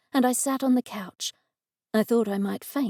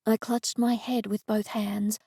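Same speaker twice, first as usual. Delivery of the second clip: ordinary delivery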